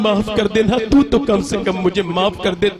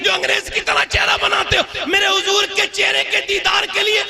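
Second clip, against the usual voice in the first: fiery preaching; 10 semitones higher than usual. Acted. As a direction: rouse the crowd with a shout. Preaching at full force.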